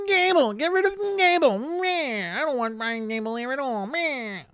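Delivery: crying and whining. Retold or imitated. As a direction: whimper like a sulking toddler fan. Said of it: "'Cause right now I cry and whine".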